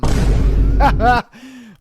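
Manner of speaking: bursts out in a loud laugh; big real laugh at the story. Real, not acted. Host laughing hard at the reddit story.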